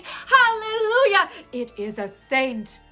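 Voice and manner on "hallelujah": high, loud, drawn-out rejoicing; jubilant cry. Acted. Gravedigger rejoicing over the saint, exultant shout. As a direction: belt it out in rapture.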